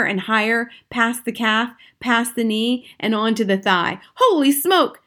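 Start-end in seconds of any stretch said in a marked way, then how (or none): 4.1-5.0 high-pitched startled cry; yelling. A narrator's reading. Cook feels the mouse climbing, yelling. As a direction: exclaim it in rising alarm. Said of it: "the man was yelling".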